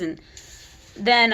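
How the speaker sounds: ordinary delivery, nothing marked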